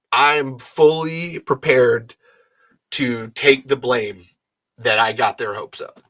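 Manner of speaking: slow, measured "I'm fully prepared"; slow admission. Real, not acted. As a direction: lay each word down slowly, owning it. First statement of taking blame.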